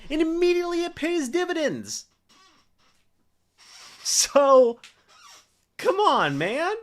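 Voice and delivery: high and loud, fired-up shout of joy. Real, not acted. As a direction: let it burst out as the move pays off. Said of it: "I got fired up".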